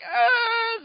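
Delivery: high startled gasp; a sudden high squeak of alarm; startled yelp. Pretend. Imagining being forced to talk Southern, gasping high and startled.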